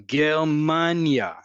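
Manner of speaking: slow Germania, leaning on MAN, falling at the end; stress on Germania's second-to-last syllable. Shown as a demonstration. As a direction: stretch MAN in Germania, then drop. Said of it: "indicate where the emphasis".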